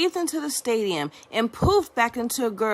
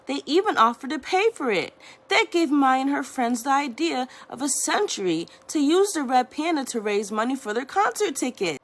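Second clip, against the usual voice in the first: plain reading voice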